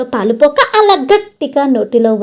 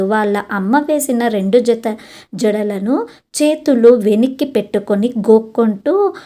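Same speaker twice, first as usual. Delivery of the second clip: ordinary delivery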